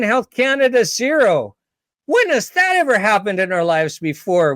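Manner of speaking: sarcastic over-the-top victory voice; mock celebration. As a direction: gush like a duped citizen celebrating a fake win. Mock triumphant Canadians celebrating.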